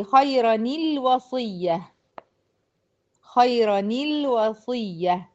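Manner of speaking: very slow "خيرا الوصية" as khayrani-lwasiyya; careful tajweed example, "خيرا الوصية" joined by a ni; demonstrating tanween link. Shown as a demonstration. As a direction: draw out "خيرا الوصية", linking with ni. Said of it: "تحريك الساكن الأول بالكسر".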